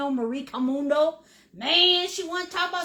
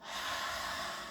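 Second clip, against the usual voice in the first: long, slow, audible exhale; deep cleansing breath; 13 dB quieter than usual. Shown as a demonstration. Teacher calming students after the freak-out, deep cleansing breath. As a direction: breathe out fully to calm down. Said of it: "Deep cleansing breath".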